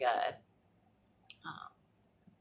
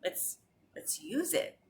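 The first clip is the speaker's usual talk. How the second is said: mock disbelief; 5 semitones higher than usual. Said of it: "and I was like".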